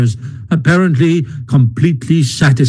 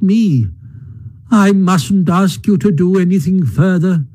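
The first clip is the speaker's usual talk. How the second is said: self-pitying Toad, feeble; 6 semitones higher than usual. A narrator's reading. Toad playing martyr.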